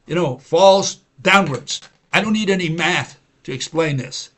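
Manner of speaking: normal talking voice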